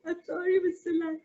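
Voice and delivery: a tiny mouse begging with chattering fear; frightened mouse pleading with the lion; stammering scared mouse. Acted.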